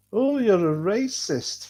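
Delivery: high, drawn-out mock outrage; sing-song mimicry of a name-caller; mocking accusation. Mocking those who call people names.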